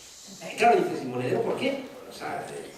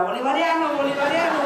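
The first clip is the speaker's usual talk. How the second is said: imita a alguien gritando, más agudo y entre risas; grítalo medio en broma, como un tertuliano; grito burlón imitado; 7 semitones higher than usual. Retold or imitated. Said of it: "Maruenda le grita". (grito de tertuliano imitado con risa)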